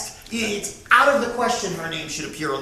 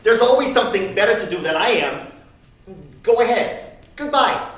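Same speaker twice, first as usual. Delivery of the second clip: loud, fast, offended mother; hurt mother; 8 dB louder than usual. Retold or imitated. Mother hanging up on her son, loud and offended. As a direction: slam the door with words. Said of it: "She said".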